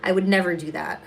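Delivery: ordinary talk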